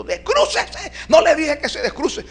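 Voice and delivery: loud, very high imitated scolding scream; hammed-up high-pitched shouted order; hard yell. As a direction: yell it hard, as the medium losing patience. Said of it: "me grita esta vez duro".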